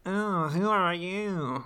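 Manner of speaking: imitated awful nerd voice; nerdy monkey. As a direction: drawl it as a nerdy little monkey. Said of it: "They all have the same voice".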